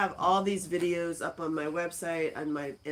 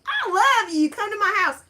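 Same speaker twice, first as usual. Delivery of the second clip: much higher, loud gushing fan; 11 dB louder than usual. Retold or imitated. Imitating fans who invite her over, high squeal. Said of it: "you're like".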